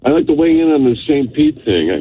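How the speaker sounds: muffled voice through a handset; muffled phone. Real, not acted. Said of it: "you sound like you're very muffled".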